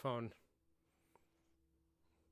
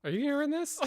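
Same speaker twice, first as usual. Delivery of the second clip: excited loud laugh; 14 semitones higher, 8 dB louder than usual. Real, not acted. Cat meowing loudly now.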